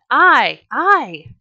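loud eager shout of volunteers. A narrator's reading. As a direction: call out loud and high, volunteering.

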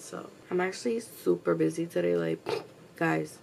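ordinary talk

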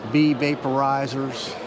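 ordinary talk, nothing marked

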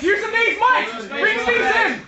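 high, urgent corner call; urgent coaching call. Real, not acted. Coach to Mike in the fight, urgent call.

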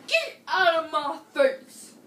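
loud mock yell through a laugh. Father refuses the five dollars, loud laughing yell. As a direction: yell it but crack up.

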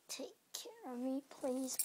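breathy whispered plea, begging for care. Pretend. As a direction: whisper it like a hurt pet. Asks pet owner for care.

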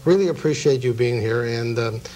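ordinary delivery

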